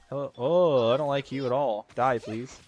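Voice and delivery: ordinary delivery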